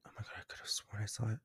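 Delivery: fast, breathless whisper; whispering to herself while scanning the room for the spider; scared whisper. Real, not acted. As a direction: hush it as if the spider could hear.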